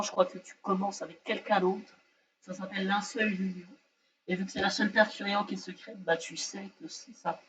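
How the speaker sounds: ordinary talk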